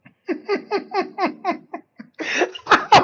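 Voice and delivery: a big squealing laugh of delight; real amused laugh. Real, not acted. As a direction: burst out laughing high. Host laughs at British torture.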